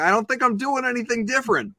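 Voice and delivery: high-pitched defensive retort; mock protest. Replying to wife's radio-voice complaint, mock defensive. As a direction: protest innocence in a lifted voice. Acting out his reply to wife.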